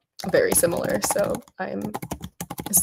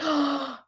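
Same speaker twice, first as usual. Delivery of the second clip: high, startled intake of breath; shocked gasp; 6 semitones higher, 3 dB quieter than usual. Real, not acted. Streamer realizing it's late for the viewer, shocked gasp.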